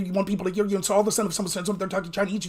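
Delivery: ordinary delivery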